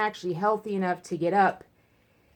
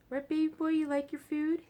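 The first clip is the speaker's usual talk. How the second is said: cooing pet talk; 10 semitones higher than usual. Real, not acted. Owner coos to her cat Taz while he eats, tender high baby talk. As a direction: coo sweetly to your cat.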